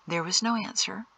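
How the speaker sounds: plain reading voice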